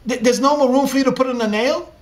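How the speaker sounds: shocked son rising in disbelief; stunned disbelief. Acted. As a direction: stammer it out, caught off guard. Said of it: "And that struck Yaakov".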